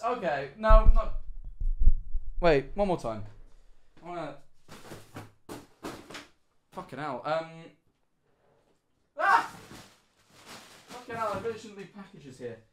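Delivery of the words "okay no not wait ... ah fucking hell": ordinary talk, nothing marked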